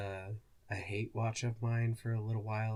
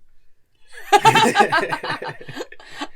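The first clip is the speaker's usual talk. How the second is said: loud high cackling laugh; big burst of laughter; 16 dB louder than usual. Real, not acted. Co-host laughing hard at her own hate-watch joke. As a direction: let a huge laugh burst out.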